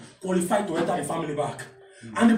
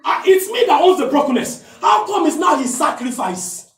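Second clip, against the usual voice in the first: preaching at full force; 8 semitones higher, 4 dB louder than usual. Acted. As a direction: drive it home with fire.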